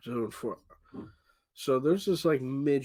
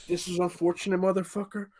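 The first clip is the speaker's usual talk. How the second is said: indignant retort; 7 semitones higher than usual. Retold or imitated. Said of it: "I'm like".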